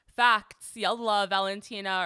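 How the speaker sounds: loud punchy read of a comment. Retold or imitated. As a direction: bark out the comment loudly. Reading a commenter's quote loudly.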